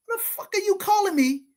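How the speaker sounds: high, loud, incredulous mock yell; retold angry outburst. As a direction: snap back in disbelief at the early call. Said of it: "I'm like".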